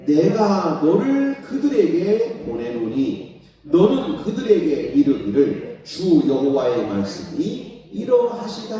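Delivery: slow, loud, solemn reading of the verses; scripture reading. Acted. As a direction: proclaim the verses slowly. Reads scripture aloud in a sermon. (느리고 크게 성경 봉독)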